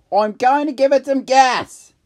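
high, loud call; yelling. Acted. May yelling to Jack before speeding up. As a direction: shout it out eagerly. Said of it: "May yelled".

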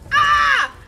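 high, very loud yelp; real scream at a sudden hit; startled scream. Real, not acted. Player suddenly hit in game, startled scream.

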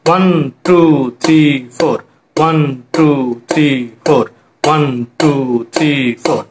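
slow, evenly drawn-out falling count; counting to a beat. Shown as a demonstration. Teacher demonstrates keeping time on a bowl, slow rhythmic counting. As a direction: stretch each number over a steady beat. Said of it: "একটা গামলা দিয়ে আপনাদেরকে একটা জিনিস বোঝাচ্ছি".

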